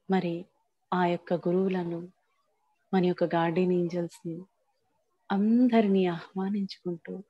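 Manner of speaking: invoke each one unhurriedly, letting phrases settle; guided invocation. Acted. Leads a guided invocation meditation.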